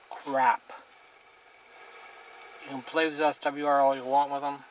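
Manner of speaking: ordinary talk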